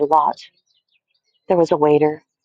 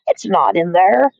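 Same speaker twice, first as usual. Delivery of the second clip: mocking shout; 8 semitones higher than usual. A narrator's reading. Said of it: "now they were shouting".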